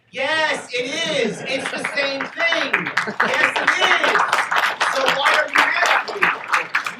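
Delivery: the speaker hammers the point home to the convention crowd, loud. Real, not acted. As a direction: shout it to the back of the hall. Rebutting anarchy critics loudly.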